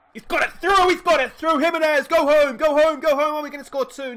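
high, loud screaming, urging the runner home; ecstatic yelling. Real, not acted. Gamer screaming at the screen as his hitter gets the ball through, ecstatic yell. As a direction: scream him all the way home.

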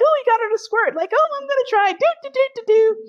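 very high, silly mock voices; playful contestants. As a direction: squeal it like eager competitors in a game. Voicing partners' playful contest.

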